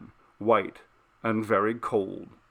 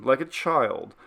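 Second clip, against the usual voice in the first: ordinary delivery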